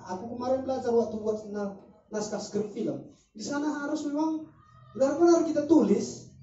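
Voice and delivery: ordinary delivery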